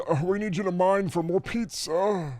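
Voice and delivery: smug slow order; mock captor. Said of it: "They ship me off".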